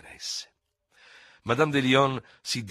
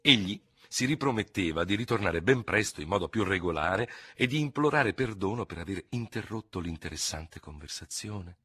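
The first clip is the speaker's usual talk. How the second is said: usual speaking voice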